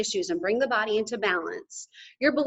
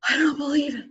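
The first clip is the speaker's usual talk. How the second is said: higher, hushed awe; stunned disbelief; 4 semitones higher than usual. Retold or imitated. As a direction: Luke breathing out his amazement. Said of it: "And Luke goes".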